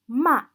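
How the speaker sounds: "ma" loud with a sharp fall; short, final falling "ma"; fourth tone. Shown as a demonstration. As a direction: drop "ma" like a command. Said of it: "when we indicate the four tones".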